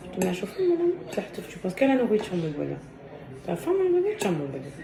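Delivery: mother cooing softly to her son while serving him; tender. Real, not acted. Mother tenderly feeding little boy.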